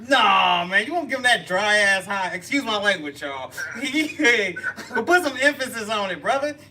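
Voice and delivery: loud, high-pitched scolding with laughter; loud mock protest. Real, not acted. Host ribbing brother over a lackluster greeting, loud mock protest. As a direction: object at full volume, teasing.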